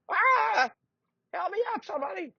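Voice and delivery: very high, fading cry for help; an old man toppling over, calling out; old knight knocked down by a moth; old man falling. Acted.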